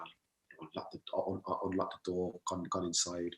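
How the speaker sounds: hushed, creeping retelling; softly, as if not to be heard; sneaking in quietly. Retold or imitated. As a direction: tell it like you're tiptoeing in. Sneaking into the house.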